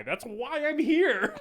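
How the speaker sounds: high, slow exclamation; hammed-up exasperated punchline; mock indignation. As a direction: stretch it out, pitch climbing high. Caps the mock spectator rant.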